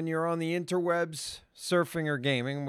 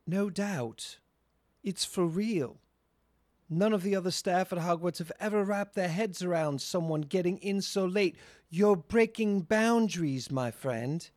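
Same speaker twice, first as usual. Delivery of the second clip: put-on British accent; 3 semitones higher than usual. Player reads slang line for co-hosts, put-on British accent. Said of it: "read it in the British accent".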